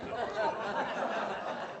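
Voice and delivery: soft breathy laugh of disbelief; laughing at the cowcatcher question; delighted laugh. Real, not acted. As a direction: laugh at the wild idea.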